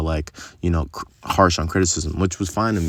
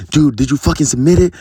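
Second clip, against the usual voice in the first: much higher, louder mock of a furious boss; retold boss yelling; 9 semitones higher, 8 dB louder than usual. Boss yelling at employee over a deal, imitated furious shout. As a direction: bark it like the boss who lost it. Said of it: "this boss yelled at me".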